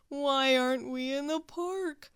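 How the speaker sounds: mopey, put-upon Walrus voice; groaning Walrus. Acted. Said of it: "groaned Walrus".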